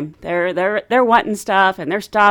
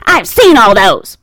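slow, loud mimicked complaint; dismissive old lady; 8 dB louder, slower than usual. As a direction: declare it slowly as a fussy passenger.

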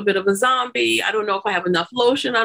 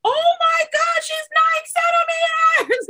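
shocked shout; slower than usual. Retold or imitated. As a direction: panic at the news. Staff shocked at dilation.

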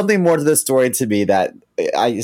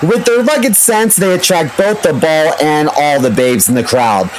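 ad read; 6 semitones higher, 5 dB louder than usual. Acted. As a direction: announce the bundle like a big reveal. Ad read as football lineup.

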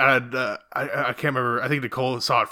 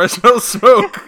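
higher, louder bystander line; mock bystander; 9 semitones higher, 10 dB louder, much slower than usual. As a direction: call it out like a background extra. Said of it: "someone says".

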